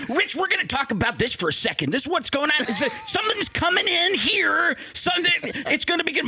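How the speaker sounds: high, strained, breathless coach voice; pushed up high, never pausing for air; Steve Mariucci impression. Imitating Mariucci talking to Rich, high and strained. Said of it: "Mariucci's like".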